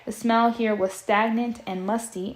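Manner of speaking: plain reading voice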